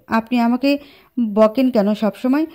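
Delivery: plain reading voice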